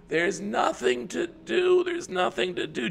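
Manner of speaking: put-on high, slow drawn-out child's whine; whiny kid. Kids complaining to mom about being sent outside.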